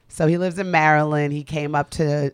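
ordinary delivery

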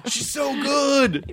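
high, stretched-out gushing; hammed-up squeal of admiration; mock impressed. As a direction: play an awestruck fan, drawn out. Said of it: "so impressed with my accent work".

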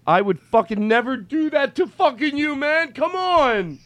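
high, wailing tearful plea; crying. Pretend. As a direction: wail come-on with drawn-out vowels. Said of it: "I'm crying because".

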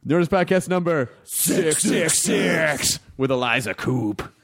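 slow, smooth devil voice; smooth polished devil. Acted. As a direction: intone it like a suave Satan. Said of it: "[name]'s got a nice devil voice".

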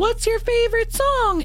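put-on high, eerie little child; sing-song like a spooky toy; creepy small child voice. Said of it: "It sounds like a small child".

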